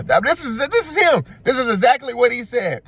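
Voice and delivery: high, loud excited aside; emphatic insistence. Real, not acted. Host swearing this is exactly what he said, excited insistence.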